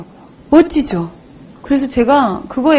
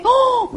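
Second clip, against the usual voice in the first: high, sudden mock gasp; imitated shocked gasp; 11 semitones higher, much slower than usual. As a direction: gasp like a startled passer-by. Onlookers gasp at her passing.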